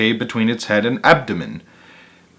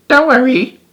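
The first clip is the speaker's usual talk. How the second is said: comforting snail; 13 semitones higher than usual. A narrator's reading. Snail slithering by comforts the beetle in a high voice. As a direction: soothe the clumsy beetle.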